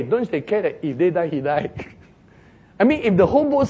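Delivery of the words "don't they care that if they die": ordinary talk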